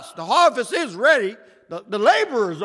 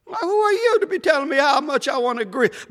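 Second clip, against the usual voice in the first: ordinary delivery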